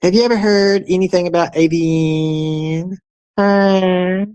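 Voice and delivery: high-pitched, drawn-out mocking voice; mocking imitation. Mocking AVN's way of talking to viewers, high put-on voice. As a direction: drag it out slow and high in a put-on voice. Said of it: "he talks like this".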